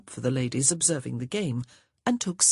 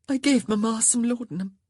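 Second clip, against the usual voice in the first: ordinary delivery, nothing marked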